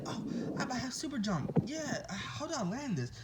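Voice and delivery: high, soft pretend amazement; excited. Deku finds he has super jump, excited. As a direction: imitate a thrilled kid, high and light.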